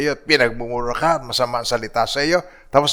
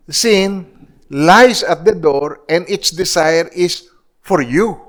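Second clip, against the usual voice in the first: higher, slow scripture proclamation; preaching at full force; 5 semitones higher, slower than usual. Acted. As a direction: proclaim God's warning slowly. Preacher quoting Genesis slowly. (mataas at mabagal na pangangaral)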